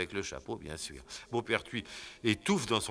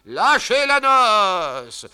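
very slow high loud order; drawn-out command; 14 semitones higher, 15 dB louder, much slower than usual. Acted. Corporal ordering the wedding released, drawn out. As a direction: call it out grandly.

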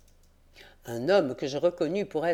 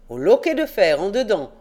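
put-on louder curt old servant; gruff old caretaker; 4 semitones higher, 8 dB louder than usual. A narrator's reading. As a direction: answer curtly like the old man. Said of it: "fit le père Jacques". (le vieux gardien répond sèchement)

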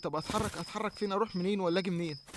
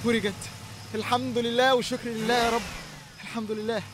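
joyful relief; 4 semitones higher than usual. Acted. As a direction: burst out in grateful relief. Car starts at last. (شكر وفرحة بصوت عالي)

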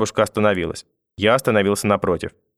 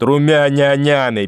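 high, slow, stretched-out taunt; mocking mimicry; 5 semitones higher, 4 dB louder, slower than usual. A narrator's reading. Grandmother jeering at grandson, mimicking sing-song. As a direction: draw out the syllables mockingly. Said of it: "передразнила она".

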